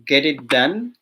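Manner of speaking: ordinary talk, nothing marked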